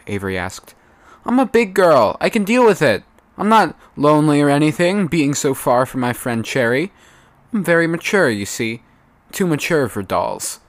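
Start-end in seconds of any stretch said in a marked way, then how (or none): 1.3-10.6 put-on high, loud childish insistence; high, loud little girl voice; Snowdrop, petulant little girl. A narrator's reading. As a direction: play the sulky kid proving she's grown up.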